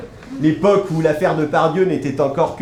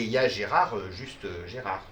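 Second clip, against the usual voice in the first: trailing off much softer and lower on the last "Gérard"; diminished, lesser Gérard; 6 semitones lower, 6 dB quieter than usual. Shown as a demonstration. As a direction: let "juste, euh, Gérard" shrink away, unimpressed. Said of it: "il y a Gérard et Gérard". (petit Gérard, voix basse qui s'éteint)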